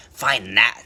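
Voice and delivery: loud, low sneer; a loud taunting sneer. A narrator's reading. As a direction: sneer it at him like a gloating eagle. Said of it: "sneered the bird".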